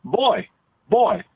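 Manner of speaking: put-on sing-song old-time Pennsylvania dialect; sing-songy rural Pennsylvania. Shown as a demonstration. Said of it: "it kinda had a sing-songy quality".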